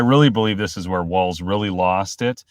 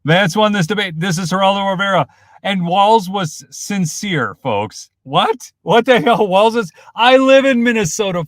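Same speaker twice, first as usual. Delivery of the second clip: loud, high outraged rant; real outburst, voice pushed up high and loud; worked-up yelling; 9 semitones higher, 8 dB louder than usual. Real, not acted. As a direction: yell it in disbelief at the camera. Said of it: "I probably yelled too much".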